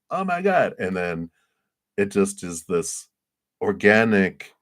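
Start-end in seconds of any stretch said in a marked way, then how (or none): 0.1-0.8 very high, delighted mock reaction; acted thrilled gasp. Potter gets invited to exhibit, acted high-pitched thrill. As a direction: play overjoyed at being asked.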